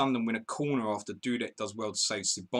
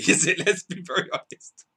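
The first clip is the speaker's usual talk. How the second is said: laughing; 8 semitones higher, 6 dB louder than usual. Real, not acted. Laughing at his bad game week.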